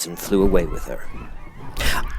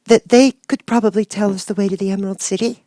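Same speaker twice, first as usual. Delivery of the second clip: put-on girl suggesting; 13 semitones higher, 3 dB louder than usual. A narrator's reading. Girl suggesting a plan, high young voice. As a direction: offer it brightly as a little girl. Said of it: "she suggested".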